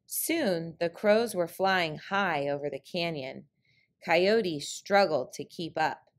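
ordinary delivery, nothing marked

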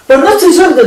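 policeman's high, loud mock yell; retold shout. Policeman snapping back at her, high loud mock yell. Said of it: "ellos me gritaban".

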